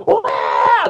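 high, open crowd cheer; mimicked wild cheering; crowd roar. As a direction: imitate a roaring crowd. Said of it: "while the crowd's going".

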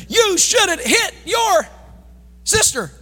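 put-on high, slow scolding shout at the kids; mock-scold the child while spanking; stern parent yelling.

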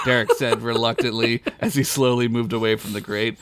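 ordinary talk

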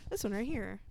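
ordinary delivery

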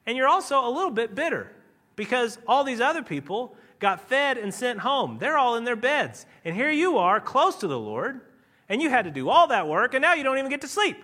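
building sermon rant; preaching at full force. Acted. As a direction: preach the disciples' grievance with fire. Preaches the disciples' bitterness.

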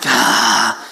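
low caught-out grunt; a short, low, sheepish "ah"; comic groan of sudden guilt; deep guilty groan. Retold or imitated. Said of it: "And I'm like".

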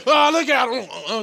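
very high, drawn-out mock cry; mocking Trump's mimicry. Co-host re-enacting Trump's stage act, mocking imitation. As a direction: stretch it out loud and high in crude mimicry.

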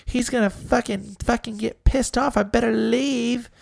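high hammed-up fearful girlfriend; mock scared girlfriend. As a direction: squeak like a scared girl rushing home. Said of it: "girl night fear mocked".

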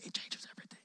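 hushed, voiceless whisper; creepy whisper. Shown as a demonstration. Preacher showing how you'd say it to your neighbor, creepy whisper. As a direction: whisper it weirdly, like a creepy secret. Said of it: "be weird and, and creepy and whisper that".

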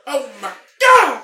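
high, loud, slow mock squeal; imitating a YouTuber. Telling friends about a sneezing compilation, high squealed mock exclamation. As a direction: ham up the shocked squeal. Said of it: "So it's just him going".

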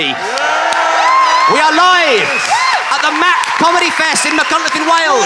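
high, loud live-show announcement; show announcer. Acted. Host introduces the tenants at the comedy fest, shouted announcement. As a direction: shout the intro over the crowd, pitched high.

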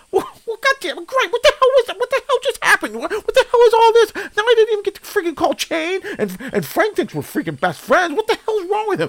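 high, fed-up ranting questions; exasperated rant. Acted. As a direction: vent at the mess in disbelief. Host rants after friend's fall.

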